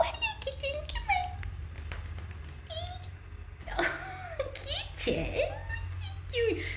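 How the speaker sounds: very high, squeaky coaxing. Real, not acted. Cat sitter coaxing a meowing kitten, high baby talk. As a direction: coax a pet in a tiny high voice.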